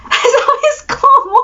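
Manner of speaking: high, slow, laughing through the words; laughing disbelief. Real, not acted. Amazed nobody scolded her, laughing high and slow. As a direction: stretch it out while laughing.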